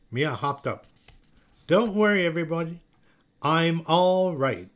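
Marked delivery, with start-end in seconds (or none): 1.7-4.7 announcing recovery. A narrator's reading. Mia hopping up after her fall, loud reassurance. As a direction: announce it grandly to the whole room. Fallen child reassures everyone loudly.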